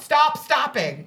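Jeremy yelling to the leader on my behalf; retold yell. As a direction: bark it out slowly like a friend helping. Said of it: "And he yelled".

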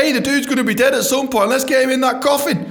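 high, loud acted argument; exaggerated comic urging; mock pushy proposal. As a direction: pitch it up and push it, hammed-up. Acted argument over a coffin.

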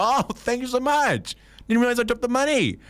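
high, gushing thank-you voice; mock grateful passerby; grateful stranger. Acted grateful reply.

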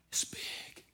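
whispered emphasis. Acted. Preacher to church, whispered emphasis. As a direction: breathe it out softly.

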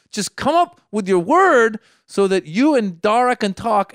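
loud, high, drawn-out inner rant; annoyed. Retold or imitated. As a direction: plead through mounting annoyance. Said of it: "I'm just getting annoyed".

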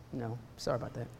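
low, very soft admission; sheepish apology. Retold or imitated. Preacher retelling being corrected by the Lord, meek and hushed. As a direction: shrink back into a small, quiet apology.

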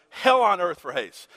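normal talking voice

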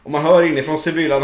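usual speaking voice

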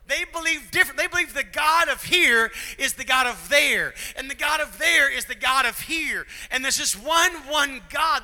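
loud, high preacher's cry; preaching at full force. Real, not acted. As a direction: drive the point home to the crowd.

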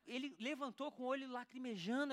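usual speaking voice

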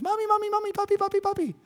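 put-on high childish whine; children calling. Pastor imitating kids calling for their parents, high and whiny. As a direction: pester like a little kid. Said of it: "de los niños".

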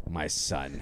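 low, soft mock-regal father; deep voice. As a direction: play a solemn warrior father. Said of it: "I keep a deep voice the whole time".

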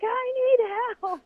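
high, slow, pleading cry; crying plea. Retold or imitated. Stranded racer pleading to the co-driver, high and slow. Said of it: "crying, screaming".

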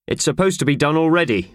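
cross, impatient boss; stern scolding. Acted.

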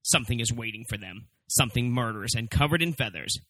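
low, dramatic trailer narration; deep movie trailer announcer; horror trailer voice. Acted.

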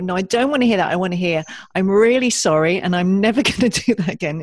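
playing a child who insists on being heard; insistent inner child. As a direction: demand it emphatically, a laugh slipping in near the end. Inner child insisting on wanted words.

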